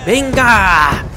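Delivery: drawn-out exasperated cry. Real, not acted. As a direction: call it out in disbelief at the game. Exasperated yell during a game.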